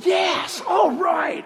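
fired-up cheer. Pretend. Preacher imitating a hyped crowd, excited shout.

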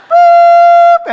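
very high, loud sustained whistle toot; piercing cartoon steam release; steam-whistle noise. Shown as a demonstration. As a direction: imitate steam shooting out like a kettle. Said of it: "steam blows out of their ears".

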